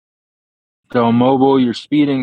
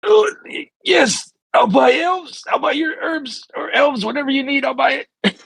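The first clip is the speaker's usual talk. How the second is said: very high, frightened babble; panicked squeak. Acted. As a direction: squeak out a terrified surrender. Character caves to the hag.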